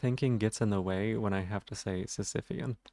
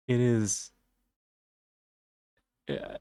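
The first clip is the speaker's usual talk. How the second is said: usual speaking voice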